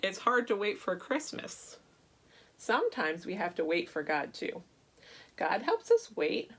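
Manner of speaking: ordinary delivery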